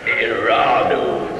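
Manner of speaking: low, slow nonsense chant; silly prize noise. Shown as a demonstration. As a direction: drone it out like a goofy jingle. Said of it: "you'll hear this noise".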